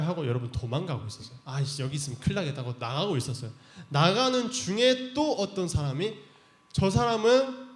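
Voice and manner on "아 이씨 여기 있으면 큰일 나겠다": panicky mutter. Acted. Peter fleeing in panic.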